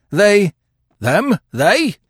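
high, loud catching of a word; startled, urgent query; quick, eager. A narrator's reading. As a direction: pounce on the word. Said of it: "said the detective quickly".

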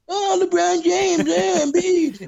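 very high, slow, dopey voice; slow and high, mocking his friend's earlier claim; mocking imitation. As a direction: parody the other guy's overconfident hype. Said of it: "I remember you know".

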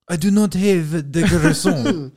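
a jokey French tourist line, lightly voiced; mock French accent. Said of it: "All you had to do was be like".

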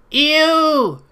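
very high, loud drawn-out "ew"; hammed-up squeal of revulsion; mock disgust. Schoolkids reacting to him in elementary school, mock disgust. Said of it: "I had enough people go".

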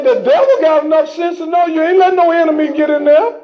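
ordinary talk, nothing marked

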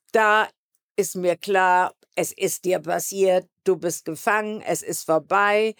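ordinary delivery

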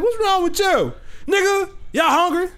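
high, put-on indignant voice; imitated angry friend. Telling friends how the roommate walked in, mock indignant. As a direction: play the friend barging in annoyed.

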